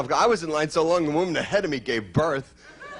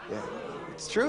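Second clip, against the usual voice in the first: ordinary talk, nothing marked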